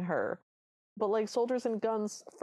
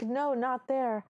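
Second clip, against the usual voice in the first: retold prince's refusal; 5 semitones higher, slower than usual. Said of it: "and he's like".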